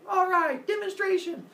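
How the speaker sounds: high, loud sing-song crowd voice; mocking crowd. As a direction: parrot the word back like a silly crowd. Crowd agrees to a demonstration.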